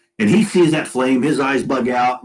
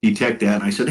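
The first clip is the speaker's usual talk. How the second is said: ordinary delivery, nothing marked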